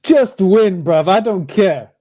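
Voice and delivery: usual speaking voice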